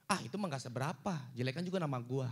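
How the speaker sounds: low, quiet, offhand brag; lightly voiced smug comic; dismissive retort. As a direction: brush it off like it's nothing.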